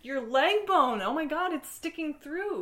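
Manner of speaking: loud, high horrified exclamation. Acted. Crew member seeing the host's leg bone sticking out, horrified. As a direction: blurt it out, appalled and high-pitched. Acted horror at protruding bone.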